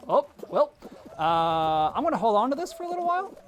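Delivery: high, loud, rushed fake-casual stall; a hammed-up nervous dodge; awkward stalling. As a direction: blurt it out nervously to buy time. Awkwardly stalling a kid, retold.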